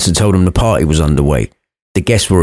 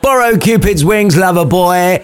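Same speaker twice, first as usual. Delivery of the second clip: mate's voice; 9 semitones higher than usual. Acted. Mate teasing Romeo.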